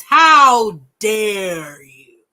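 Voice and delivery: slow commanding indignation; righteous voice. Shown as a demonstration. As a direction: draw each word out with weight. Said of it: "That's your righteous voice".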